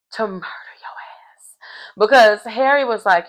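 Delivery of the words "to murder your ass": whispered aside, very soft; menacing whisper. Acted. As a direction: whisper it like a secret threat. Acting Harry's secret menacing aside.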